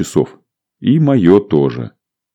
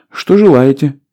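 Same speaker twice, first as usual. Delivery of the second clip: stewardess voice; 8 semitones higher than usual. A narrator's reading.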